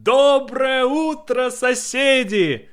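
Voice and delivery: high, drawn-out cheery call; Russian dub impression. Doing the Russian version of that scene, high cheery call. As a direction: call out slowly and exuberantly in Russian. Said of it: "the Russian version of that scene".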